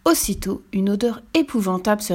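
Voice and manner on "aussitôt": usual speaking voice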